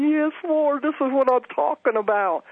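high-pitched imitation of crying out; high, mock-weepy voice; retold tearful cry. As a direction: re-enact a tearful, high plea to the Lord. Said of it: "and I'm crying. I'm going".

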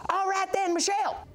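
high, hollered summons; preacher calling out. Retold or imitated. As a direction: imitate an excited preacher calling someone over. Imitates preacher calling a helper.

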